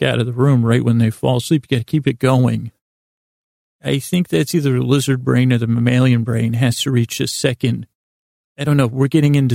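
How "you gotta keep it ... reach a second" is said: ordinary talk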